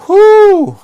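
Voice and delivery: loud high "whew" of venting; exasperated whoop. Real, not acted. Director worked up thinking about this, loud exasperated whew. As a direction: blow off steam with a high whoop. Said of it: "It made me mad thinking about this".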